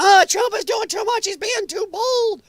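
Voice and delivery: very high-pitched hysterical mimicry; mock screaming. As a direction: imitate panicked critics in a shrieking falsetto. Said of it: "There's the people have been screaming".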